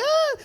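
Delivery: sudden high-pitched yelp; a short shrill squeal. Retold or imitated. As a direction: yelp high as the dice hit. Dice dumped on his face.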